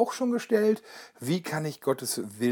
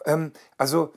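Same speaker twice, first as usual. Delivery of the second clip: usual speaking voice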